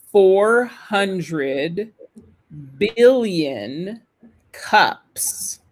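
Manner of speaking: word by word with long pauses; careful, drawn-out pronunciation demo in small chunks; slow model reading. Shown as a demonstration. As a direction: read slowly so learners can shadow. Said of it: "répéter la première phrase lentement".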